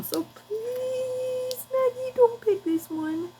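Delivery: high, soft, begging little voice; mock plea to a pet; pleading to the dog. Begging her dog not to pick.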